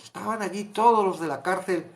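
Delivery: ordinary delivery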